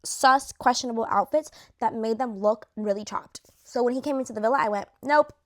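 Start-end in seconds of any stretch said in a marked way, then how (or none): 5.0-5.3 loud flat refusal; firm nope. Retold or imitated. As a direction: shut it down hard. Rejecting Pepe's outfit.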